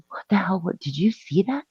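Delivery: soft, incredulous retold question; acted hushed disbelief; hushed bewilderment. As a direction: ask urgently but keep it down. Retold hushed bewilderment after scare.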